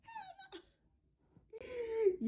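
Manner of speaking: high squeaky laughing mock mom; a high, breaking laugh-cry imitation of mom; mom's shocked reply. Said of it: "She like".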